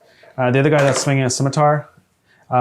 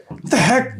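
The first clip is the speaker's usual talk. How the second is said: high, raised cartoonish complaint; exasperated bandit; 10 semitones higher, 4 dB louder than usual. Bandit baffled by the dodgy little chicken, high exasperated voice. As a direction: gripe in a squeezed high character voice. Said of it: "The, the guy's like".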